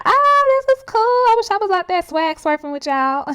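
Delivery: very high, loud delighted squeal settling down; excited gushing. Retold or imitated. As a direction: gush over a party you wish you were at. Acts out envy at the party.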